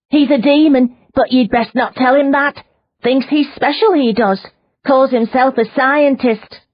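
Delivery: high, loud, half-crazed woman's voice; a captive woman rambling about a demon; frightened prisoner woman. Acted. Prisoner warns Leona about her captor, high and agitated.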